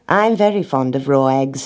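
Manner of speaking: plain reading voice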